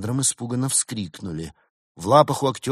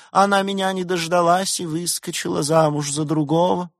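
tragic lovelorn fox; 12 semitones higher, 8 dB louder than usual. A narrator's reading. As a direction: wail your heartbreak theatrically. Tragic fox hero Vechenson speaks. (трагический влюблённый лис, высоко и надрывно)

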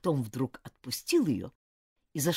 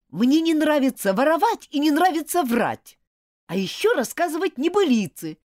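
angry outburst; 10 semitones higher, 7 dB louder than usual. Acted. Boy angrily breaking friendship.

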